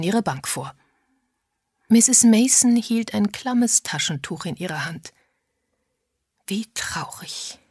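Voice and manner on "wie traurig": slow, hushed funeral whisper; soft, drawn-out whisper. A narrator's reading. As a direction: whisper it slowly to a fellow mourner. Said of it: "flüsterte sie Agatha zu".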